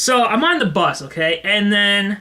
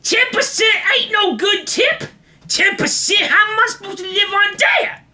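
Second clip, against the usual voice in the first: ranting bus passenger; 13 semitones higher than usual. Retold or imitated. Drugged man complains about tips to nobody, high holler. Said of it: "he's just like".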